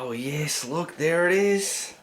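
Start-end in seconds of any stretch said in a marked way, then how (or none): 0.0-1.0 suddenly hushed. Real, not acted. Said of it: "Inside voice".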